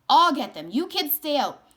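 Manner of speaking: loud commanding shout; urgent order. Acted. Man grabbing the boy's arm and ordering him out, loud. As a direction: hold the boy back with your voice. Man stopping boy at church.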